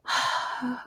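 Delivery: long audible exhale; heavy sigh. Real, not acted. Teller recalling a happy night, heavy sigh. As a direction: let out a wistful sigh. Overcome by the memory, sighs.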